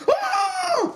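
high laughing battle shriek; mock attack yell. Brief charging at the villain, laughing high shriek. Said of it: "attack Savory in a manner like".

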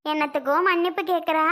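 ordinary talk

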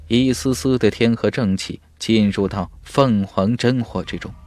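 plain reading voice

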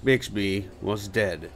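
usual speaking voice

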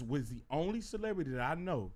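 ordinary talk

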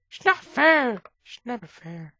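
put-on high, loud childish whine; mock-childish complaint that it's not fair; whiny voice. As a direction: sulk like a spoilt kid. Whining at a game loss.

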